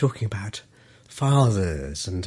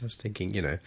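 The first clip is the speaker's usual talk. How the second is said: usual speaking voice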